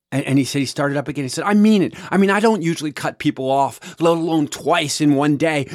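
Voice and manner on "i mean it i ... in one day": high, loud, frantic plea to be put out of misery; pitched-up, pushed voice of a man having the worst day; desperate plea. Retold or imitated. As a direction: beg like a man at the end of his rope. Mark pleading with angry driver.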